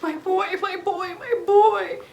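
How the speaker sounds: loud, high mock cry of joy; overjoyed cry. Mom embraces her son come alive, crying out overjoyed.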